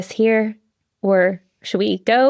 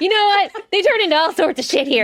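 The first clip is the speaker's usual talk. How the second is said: breaking character giggling; 7 semitones higher than usual. Real, not acted. GM laughing at table.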